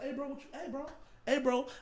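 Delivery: retold hushed heads-up; imitated attention-getting. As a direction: try to catch a player's attention discreetly. Re-enacts trying to stop bassist.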